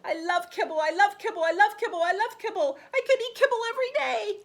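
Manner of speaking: high, loud, gushing chant with a laugh; giddy delight. Acted. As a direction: bubble over with joy about kibble. Dog gushes over kibble.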